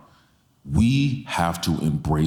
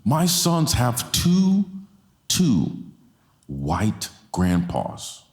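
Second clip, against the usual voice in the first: impassioned preaching; 11 semitones higher than usual. Acted. Pastor pressing the church to embrace differences, impassioned preaching. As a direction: preach it home, each phrase climbing higher. Preacher builds to full force.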